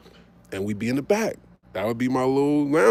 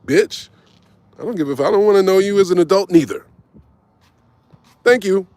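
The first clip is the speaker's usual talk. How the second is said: loud, fast, cutting kiss-off; passing his ex on the street, he brushes her off loudly; dismissive brush-off; 6 semitones higher, 6 dB louder than usual. Retold or imitated. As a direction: snap it at her and keep walking. Brushing off ex in Times Square.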